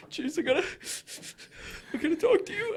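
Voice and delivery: imitating panicked friend. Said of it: "And you're like".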